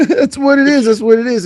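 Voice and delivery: high laugh through the words. Real, not acted. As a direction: laugh while repeating it. Laughing at own joke.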